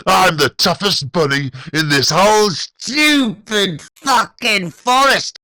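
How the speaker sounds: high, loud, slowing boast; tough-guy shout. A narrator's reading. Ian ignoring David, shouting his toughness. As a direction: yell it to the whole forest.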